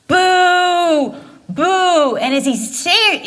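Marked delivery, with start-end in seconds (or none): 0.1-2.2 high, louder mock boo from the crowd; hammed-up mock heckling boo. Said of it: "as Dave's booing".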